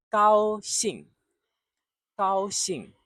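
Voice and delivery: gao high and level, xing a sharp fall; high gao, then drop hard on xing; 高兴，一声四声; tones one and four. Shown as a demonstration. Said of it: "First tone and then fourth tone".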